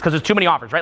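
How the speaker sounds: usual speaking voice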